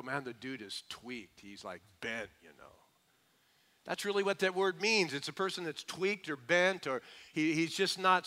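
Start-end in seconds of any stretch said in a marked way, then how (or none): 0.0-2.6 offhand buddy talk, dropped low and soft; like a guy shrugging about a friend; mildly voiced street slang; casual slang voice. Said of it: "and you go".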